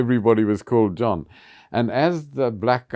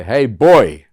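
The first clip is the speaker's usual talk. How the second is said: put-on a loud, sudden "Hey, boy"; retold shout; 3 semitones higher, 13 dB louder than usual. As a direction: fling the insult out loud. Actor hurls insult on stage.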